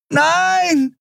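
high, anguished scream; long high desperate cry; screamed refusal. A narrator's reading. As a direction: scream it in despair. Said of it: "schrie Andriel".

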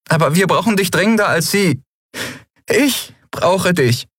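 high and urgent, slowing to a plea; young Endriel pleading. Acted. Endriel begging Xea not to leave, pleading. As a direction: beg her to stay.

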